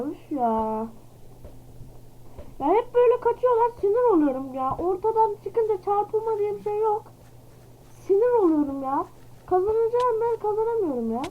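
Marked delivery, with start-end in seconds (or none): 2.6-11.2 exasperated rant. Real, not acted. Losing, says he gets angry.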